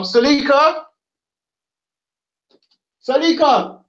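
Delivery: high-pitched call to another room. Real, not acted. Man calling his fiancee in from the kitchen, calling out. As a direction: call the name out high, drawing attention.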